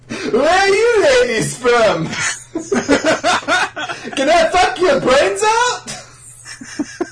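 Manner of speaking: very high, laughing and drawn out; high silly voice. Acted. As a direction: squeak it out through giggles. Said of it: "it's now Benzai".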